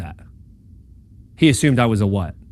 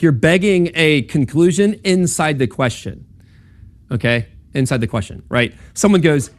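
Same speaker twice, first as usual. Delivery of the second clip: ordinary delivery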